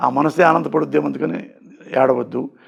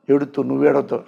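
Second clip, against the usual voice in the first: ordinary talk, nothing marked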